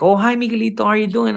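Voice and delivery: high sing-song lady greeting; Jenny's greeting. Retold or imitated.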